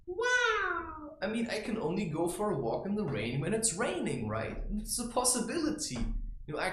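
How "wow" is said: amazed wow. Real, not acted. Marvelling at possibilities.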